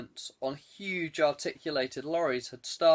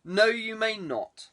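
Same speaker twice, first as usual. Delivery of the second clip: curt refusal; 6 semitones higher, 4 dB louder than usual. Acted. Lord cuts off the superintendent.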